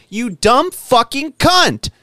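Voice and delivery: imagined shouting match with a smug shopper; yelling at her. Retold or imitated. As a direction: let it rip, dragging every word out. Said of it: "I was like".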